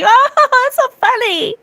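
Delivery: very high fake-laughing squeal; hammed-up high-pitched fawning; mock laughing friends. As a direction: gush with exaggerated fake laughter. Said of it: "all your friends are like".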